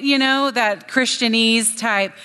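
ordinary talk

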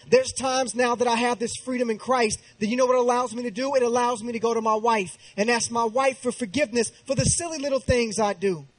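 ordinary talk, nothing marked

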